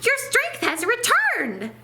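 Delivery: high, bright mouse exclamation; exclaimed. A narrator's reading. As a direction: burst out in delight. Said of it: "exclaimed Mouse".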